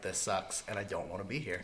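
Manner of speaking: low, quiet mock grumble; retold sulking. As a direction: sink into a dejected undertone. Retold salty mood as light fades.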